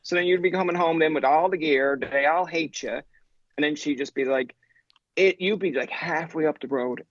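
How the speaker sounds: usual speaking voice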